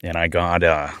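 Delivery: low, halting Australian warm-up; Australian accent. Shown as a demonstration. Voice actor warming up into an Australian accent. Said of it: "I have to get Australian".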